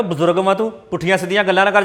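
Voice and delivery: ordinary delivery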